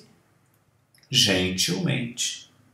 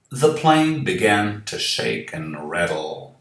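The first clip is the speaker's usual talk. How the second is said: story reading; 3 semitones lower than usual. A narrator's reading. Reads the story text.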